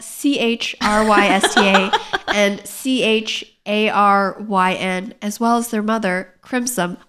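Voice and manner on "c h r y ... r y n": slow letter-by-letter spelling; spelled out slowly. Shown as a demonstration. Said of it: "That's spelled".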